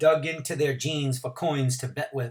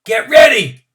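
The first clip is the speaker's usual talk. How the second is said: full-throated shout, much higher and louder; yelled; 11 semitones higher, 17 dB louder than usual. A narrator's reading. Starter Bump Gilliam yelling to the runners, full shout. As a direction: yell it to start the race.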